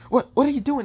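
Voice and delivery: very high, stammered question; startled protest. Acted. Maiden questions S F while dragged off, high startled protest.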